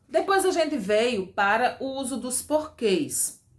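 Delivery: usual speaking voice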